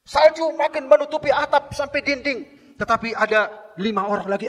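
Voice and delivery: loud fervent sermon voice; high, loud pulpit delivery; preaching at full force. Acted. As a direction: preach the story to the back row.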